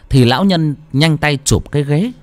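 ordinary delivery, nothing marked

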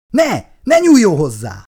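high, loud alarmed cry of no; shouting out. A narrator's reading. Sebastian warns Connors not to touch the weapon, shouting in alarm. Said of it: "kiáltott fel Sebastian".

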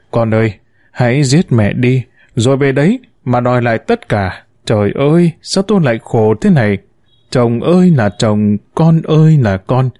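usual speaking voice

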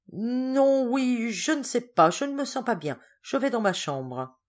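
high, drawn-out hesitant excuse; flustered. A narrator's reading. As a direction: stall uneasily while inventing an excuse. Georges troublé cherche une excuse. (troublé, hésitant, voix plus aiguë)

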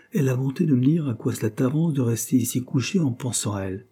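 ordinary delivery, nothing marked

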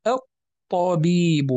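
الطبيب drawn out as "at...tabiibu"; slow demonstration of الطبيب with silent lam; doubled ta. Shown as a demonstration. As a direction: hold the doubled ta in الطبيب, slowly. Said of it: "لأنه أصلها طبيب غير مشددة".

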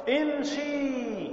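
very slow, drawn-out امشي; drawn-out model word. Shown as a demonstration. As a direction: stretch امشي out slowly as a model. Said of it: "فنقول". (امشي ببطء شديد ومد)

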